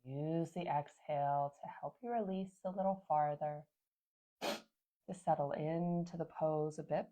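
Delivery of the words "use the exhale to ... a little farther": ordinary talk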